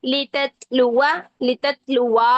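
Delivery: लिततलुवा slow, alif not lengthened; लिततलुवा, no long a before wa; Qur'anic recitation. Shown as a demonstration. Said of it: "अलिफ मद्दा नहीं बनाएंगे".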